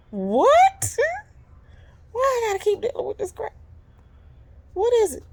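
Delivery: high, fast fed-up cry; exasperated. Real, not acted. Tired of disrespect, she cries out high and exasperated. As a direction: throw up your hands in frustration.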